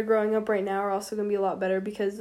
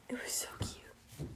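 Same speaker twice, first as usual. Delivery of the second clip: whispering; 20 dB quieter, slower than usual. Real, not acted. Gushing about sister's note.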